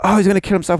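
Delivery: surprised. Retold or imitated. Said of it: "I was like".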